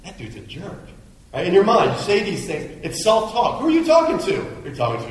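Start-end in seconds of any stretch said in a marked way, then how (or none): 0.0-0.9 muttered thought. Retold or imitated. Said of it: "Or, or".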